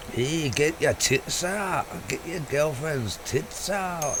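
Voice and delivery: put-on a Scottish accent gone wrong; bad Scottish accent. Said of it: "the worst Indian Scottish accent I've ever heard".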